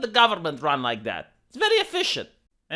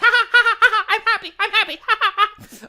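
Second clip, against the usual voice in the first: much higher and louder forced laughing; mock happy laughter; 7 dB louder, faster than usual. Mocking carless citizens who own nothing, high forced laughter. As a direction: cackle like a brainwashed happy citizen. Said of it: "They're like".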